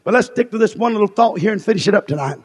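normal talking voice

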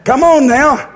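ordinary talk

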